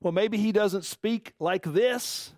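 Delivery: normal talking voice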